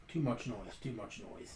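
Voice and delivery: low, very quiet grumble; small complaining voices. Acted. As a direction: let it fade to almost nothing. Game master voices complaining pawns.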